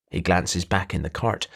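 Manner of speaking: plain reading voice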